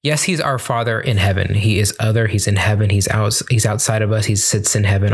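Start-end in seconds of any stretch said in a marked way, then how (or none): none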